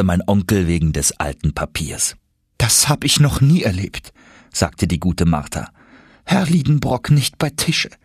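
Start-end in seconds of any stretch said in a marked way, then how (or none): 2.6-7.9 put-on higher, mildly astonished old maid; lightly voiced housekeeper. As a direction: marvel at the master missing lunch. Martha marvels at empty seat.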